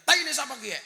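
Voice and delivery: loud, high shout of disgust; startled yell. Retold or imitated. Ngapak guest yells at Sunda host, loud and high. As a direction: yell it out after stepping in dung. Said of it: "Langsung dia teriak".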